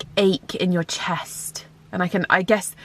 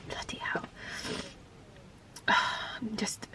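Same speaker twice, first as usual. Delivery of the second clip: breathless whisper between sighs; sighing through tears; 15 dB quieter, slower than usual. Real, not acted. Crying over her sick dog.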